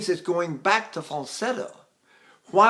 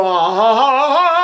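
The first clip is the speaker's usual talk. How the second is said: louder, very slow ah that cracks; cracking ah; 7 semitones higher, 10 dB louder than usual. Shown as a demonstration. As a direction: let the ah crack as the breath lets go. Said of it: "So that's my crack".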